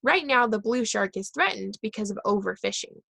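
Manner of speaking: plain reading voice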